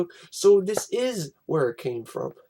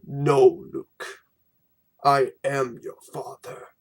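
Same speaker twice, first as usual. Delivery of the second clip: low, deep Vader reveal; lightly voiced Vader; 3 semitones lower, 4 dB louder, slower than usual. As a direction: deliver the big reveal as Darth Vader. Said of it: "that's how it's said".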